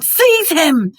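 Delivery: high, loud scream of a command. A narrator's reading. Voldemort ordering Quirrell to grab Harry, screaming. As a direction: scream it at your servant. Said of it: "but Voldemort screamed".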